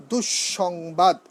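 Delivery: "দুঃসংবাদ" slow, with a long double sh after du; doubled s. Shown as a demonstration. Teacher showing visarga doubling, "দুঃসংবাদ" as dush-shongbad, slowly. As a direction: hold the doubled sibilant in "দুঃসংবাদ". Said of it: "মানে দুবার উচ্চারণ হবে".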